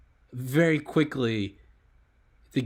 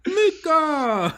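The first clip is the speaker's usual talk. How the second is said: high, loud mock cry; hammed-up game-character call; 7 dB louder than usual. Friend parodying Grand Theft Auto, hammed-up shout. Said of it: "you sound like fucking Nico Bellic".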